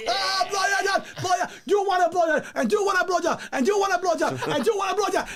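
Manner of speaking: very high, shouted hype chant; mock mom shout. Son imitating his mother hyping shots, high shout. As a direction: pump up the bar like the mother. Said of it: "You just put it".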